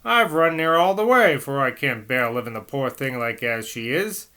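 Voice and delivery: Cockney. Acted. Nurse begging the priest for morphine, urgent Cockney. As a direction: plead in a Cockney accent, out of breath. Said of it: "I'm gonna have to do some Cockney".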